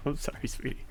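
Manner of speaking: soft words through laughter; laughing apology. Real, not acted. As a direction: apologize gently, still laughing.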